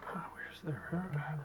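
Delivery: slow breathy whisper, muttering under the breath; hunting for the spot in a slow whisper; whispered to himself. Real, not acted. Searching for something while tying.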